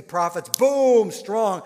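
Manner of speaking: big loud slow boom on the prophets; booming emphasis. Acted. Preacher booming out the strength of four hundred prophets. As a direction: hammer the word boom out.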